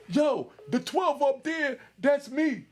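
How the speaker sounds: high, drawn-out call for attention; mock yelling. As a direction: imitate a rapper yelling out to his people. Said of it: "He start yelling".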